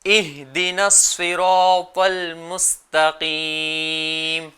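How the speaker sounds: اهدنا الصراط المستقيم drawn out very slowly, each letter articulated; تلاوت آہستہ، ہر حرف صاف; slow careful recitation. Shown as a demonstration. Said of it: "اس میں دو غلطیاں عام طور پہ ہوتی ہیں".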